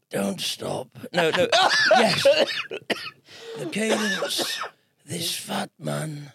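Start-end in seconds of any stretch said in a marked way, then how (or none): 3.6-6.3 Attenborough attempt. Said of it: "Go on, you try it".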